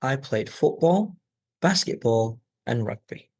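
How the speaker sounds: rising on "football" and "basketball", falling on "rugby"; list intonation. Shown as a demonstration. Teacher modelling three-item list practice, rise, rise, fall. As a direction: lift and pause after "football" and "basketball", drop on "rugby". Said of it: "practice that intonation".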